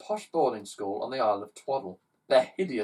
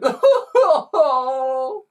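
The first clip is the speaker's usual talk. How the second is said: high loud wailing sobs; symphony of sobbing; chorus of sobbing; 13 semitones higher, 12 dB louder than usual. Acted. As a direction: howl like a crowd of crying kids. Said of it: "set all the children off".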